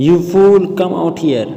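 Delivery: loud high angry call into the well; boy's furious shout into a well; calling out angrily. A narrator's reading. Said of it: "John then called".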